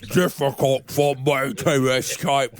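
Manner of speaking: slow, gurgling drowning-wizard voice; Alan Moore impression. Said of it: "impression is to sound like a drowning wizard".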